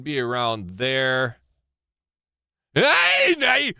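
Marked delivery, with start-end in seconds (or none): 2.7-3.7 sharp high shout. Real, not acted. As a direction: call out hey, hey loud and high. Reacting to the scene.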